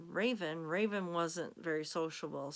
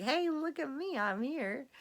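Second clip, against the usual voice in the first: cat voice; 9 semitones higher than usual. Pretend.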